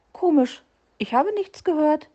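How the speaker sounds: high, chirpy little bird voice; peeping little bird. A narrator's reading. Little blue bird puzzled by the fox, peeping high. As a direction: peep it out like a tiny blue bird. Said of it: "piepste der kleine blaue Vogel".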